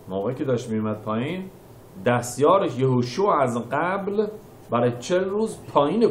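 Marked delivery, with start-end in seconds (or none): none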